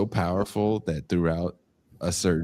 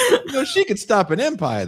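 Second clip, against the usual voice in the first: put-on admiring old-timer. Same admiring voice.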